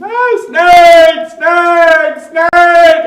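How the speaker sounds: high, very loud mock-terrified shouts; acted-out shriek of panic; panicked screaming. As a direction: scream as if freaking out at snakes. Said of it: "he was, like, freaking out".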